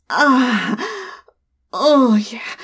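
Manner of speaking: normal talking voice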